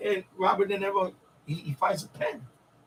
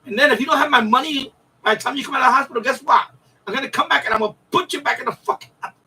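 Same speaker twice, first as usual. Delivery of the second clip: mock mobster threat; 3 semitones higher, 4 dB louder, faster than usual. Gangster warning the banker about the hospital, hammed-up fast threat. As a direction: spit the threat out quickly like a movie mobster.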